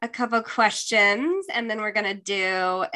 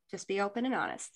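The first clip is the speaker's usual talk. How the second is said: whispering; 10 dB quieter, faster than usual. Real, not acted. Wrapping up with soft advice.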